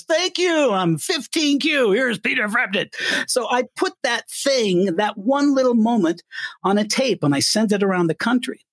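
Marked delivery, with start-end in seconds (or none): 0.0-2.9 playful imitation of the on-air bit; mock DJ patter. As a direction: slide from the singer's voice into radio host. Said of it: "And he goes".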